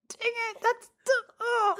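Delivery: high, exasperated mock protest; retold frustration. Retelling her anger at the inner voice, high and exasperated. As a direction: whine it up high in mock outrage. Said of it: "I was so mad".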